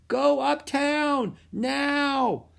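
high, drawn-out urgent yell; yelling loudly. A narrator's reading. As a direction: yell it over the roaring waves. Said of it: "both yelled as loudly as they could".

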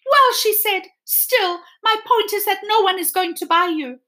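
high, prim doll voice; haughty doll. Acted. The china doll insisting nobody will buy Edward, haughty. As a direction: get the last word like a vain doll. Doll's last word.

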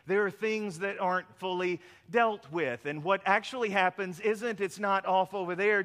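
usual speaking voice

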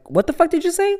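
high-pitched incredulous question; retold disbelief. Stunned by mom's explanation, high incredulous retort. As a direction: react in mock shock, pitch shooting up. Retelling shocked reply to mom.

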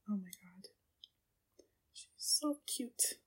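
very soft, whispered adoration; awed whisper. Real, not acted. Viewer to herself over the cute character, awed whisper. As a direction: whisper it, overcome by cuteness.

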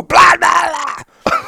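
high, loud mock snap of blah blah blah; mock snapping. Husband brushing off his wife's loving question, high mock snap. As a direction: bark it out like a grumpy brush-off. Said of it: "Why did you talk like that?".

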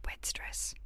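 fainter unvoiced whisper of Wed stress; barely audible whisper. Shown as a demonstration. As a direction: let Wed stress fade to a breath. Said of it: "whispered repeat".

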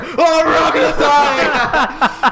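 high, drawn-out shout of panic; mock scream. Friend creating a distraction on the bus, mock panicked scream. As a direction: scream it as a hammed-up alarm. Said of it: "just started screaming".

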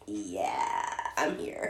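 low, lazy dude voice; laid-back dude. Retold or imitated. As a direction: slouch into a low, husky dude drawl. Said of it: "just like".

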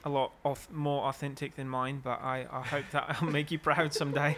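put-on amateur Aussie accent, halting delivery; attempted Australian accent. Band member addressing [name] about his accent, attempted Australian accent. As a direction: feel your way through an Australian accent. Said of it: "I'm trying to do, uh, Australia".

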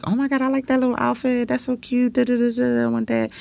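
put-on high, fast gushing girly voice; excited fan voice. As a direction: gush over the outfit, high and quick. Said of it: "and they're like".

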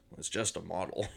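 quoted as a soft, offhand aside; throwaway remark. Retold or imitated. Fan retelling Patsy's joke, throwaway aside. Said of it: "a funny little throwaway joke".

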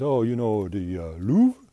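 put-on French accent, "Louvre" rising high and loud; imitated French guy. French guy prompting the CEO, loud mock French accent. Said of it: "French guy says".

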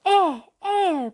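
high, loud indignant call; angry call down to the sea; loud protest. Acted. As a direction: shout in protest from above. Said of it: "protestó Calderaus".

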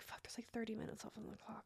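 hushed panic at the clock in a sleepy room; hushed alarmed aside. Retold or imitated. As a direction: hiss it under your breath. Noticing the clock during sleepy massage.

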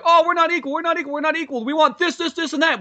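high, loud mocking whine; mock a whiny complainer; whiny demanding voice. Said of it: "which technically what they're asking for".

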